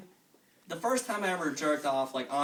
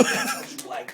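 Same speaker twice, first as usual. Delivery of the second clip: unvoiced, pitched-up whisper; creepy breathy whisper; breathy mock whisper; 6 dB louder than usual. Whispered self-talk.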